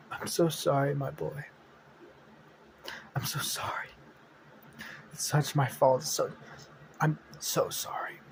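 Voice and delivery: soft, half-whispered, a touch of tears; tearful apology. Acted. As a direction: apologise brokenly, barely voiced. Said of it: "she starts crying a little bit".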